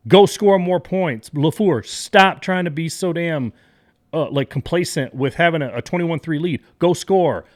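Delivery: frustrated fan yelling at the coach; angry. Real, not acted.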